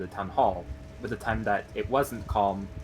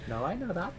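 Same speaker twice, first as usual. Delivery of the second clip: smiling voice; 8 semitones higher, 4 dB quieter than usual. Acted. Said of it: "You can hear her smile".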